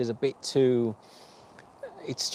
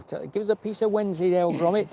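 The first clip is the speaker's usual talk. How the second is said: Wallace impression; 7 semitones higher than usual. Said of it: "like Wallace and Gromit".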